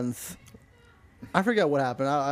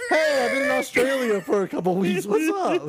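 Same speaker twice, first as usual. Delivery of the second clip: loud, high, over-cheery hello; booming fake-friendly phone call; mock breezy greeting; 10 semitones higher, 4 dB louder than usual. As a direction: shout a hammed-up casual excuse. Acts out fake excuse phone call.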